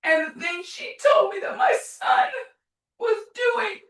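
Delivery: high, breaking voice through sobs; words cracking into tears; crying. Real, not acted. Mother recounting son, crying.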